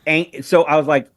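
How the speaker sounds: normal talking voice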